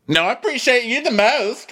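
a high, coy "I appreciate you the most", lifting on "the most"; flirty mock gush. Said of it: "Wink, shimmy".

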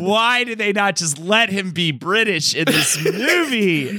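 high, slow, laughing outrage; laughing disbelief. Real, not acted. Host laughs at the actor's accent to cohost, incredulous. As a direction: laugh through the question in disbelief.

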